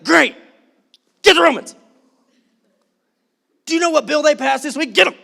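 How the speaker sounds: loud, high rallying cry to attack; hammed-up call to get the Romans; mock eager shout. Crowd urging Jesus to attack Rome, mock eager shout.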